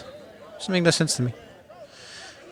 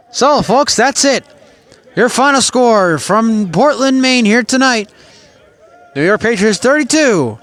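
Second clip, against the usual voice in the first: announcer sign-off; 4 semitones higher than usual. Acted. Broadcaster's closing sign-off.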